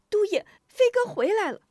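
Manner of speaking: high, hurried soldier's voice; a young soldier bursting in with news; soldier reporting. A narrator's reading. Soldier runs in to report to his lord, high and eager. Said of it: "一个兵丁抱着飞鸽匆匆进来".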